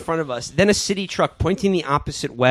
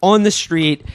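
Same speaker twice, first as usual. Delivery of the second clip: ordinary talk, nothing marked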